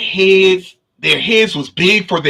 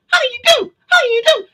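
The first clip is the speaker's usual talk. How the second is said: very high squeaky repeated greeting; little being's high chant; high munchkin voice; faster than usual. Retold or imitated. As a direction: chirp it like a tiny creature. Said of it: "One of them was saying".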